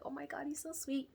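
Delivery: high, gushing coo; smitten cooing. Retold or imitated. Patient reacting to the handsome doctor's greeting, smitten cooing.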